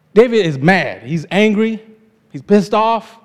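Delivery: usual speaking voice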